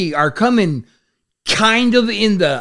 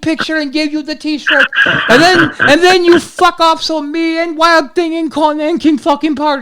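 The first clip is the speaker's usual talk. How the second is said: high, loud, laughing Iron Sheik; gleeful Sheik; 9 semitones higher, 4 dB louder than usual. Retold or imitated. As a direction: crow with delight like the Sheik. Sheik celebrating the drugs.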